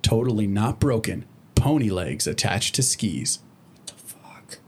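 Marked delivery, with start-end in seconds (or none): 3.7-4.6 shocked breathless whisper. Acted. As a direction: whisper it in disbelief. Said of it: "he said whispering".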